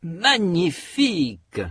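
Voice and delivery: slow, MA of Magnifique hit hard; emphatic stress. Shown as a demonstration. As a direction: insist on the first syllable of Magnifique. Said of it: "Répétez".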